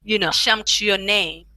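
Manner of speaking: normal talking voice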